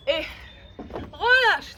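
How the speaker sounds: high, loud call to stop; loud release call. Real, not acted. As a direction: shout the cue to release. Coach ending the set.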